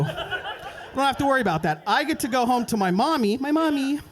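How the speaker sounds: ordinary delivery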